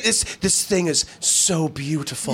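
slow, drawn-out mock reverence; gushing art lover, sent up; mock awe. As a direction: imitate someone rapt by beauty, slowly. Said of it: "And he's saying".

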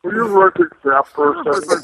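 high, lilting creepy killer voice; a hint of a creepy serial killer; Buffalo Bill voice. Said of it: "we like to do the Buffalo Bill voice".

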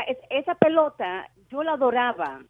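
ordinary talk

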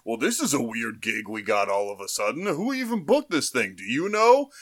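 mock confused wrestler, pitch climbing; puzzled wrestler. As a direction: wonder aloud who booked the gig. Voicing wrestlers on the bus.

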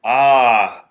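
ordinary delivery

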